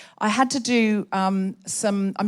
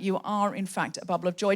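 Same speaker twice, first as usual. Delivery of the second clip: normal talking voice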